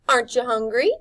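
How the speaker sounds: Rictus voice. A narrator's reading. Rictus voiced in read story.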